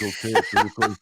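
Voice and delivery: loud, joking Minnesota accent; northern Minnesota accent. Shown as a demonstration. Mimicking frozen-faced hometown talkers for the host, Minnesota accent. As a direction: ham up the Minnesotan for a laugh. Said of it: "You talk like that in Minnesota".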